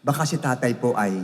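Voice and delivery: ordinary delivery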